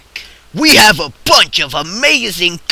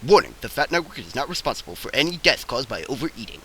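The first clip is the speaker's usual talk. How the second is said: rushed, softer fine-print read; very fast, mumbled small print; fast disclaimer; 7 semitones lower, 11 dB quieter, much faster than usual. Acted. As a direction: rattle off the legal warning.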